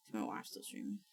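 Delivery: unvoiced whispered question. Real, not acted. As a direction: breathe it out without voice.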